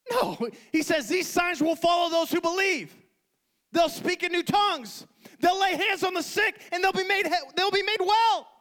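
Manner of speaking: high-pitched, impassioned preaching; full-force preaching. Real, not acted. Preacher challenging believers with Jesus' words, full-force preaching.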